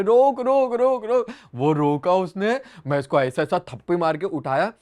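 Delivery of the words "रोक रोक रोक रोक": higher mock shouts; imitating crowd yelling. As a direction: echo the guys shouting stop. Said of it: "भाई लोग चिल्ला रहे". (लोगों की "रोक, रोक" चीख की नकल)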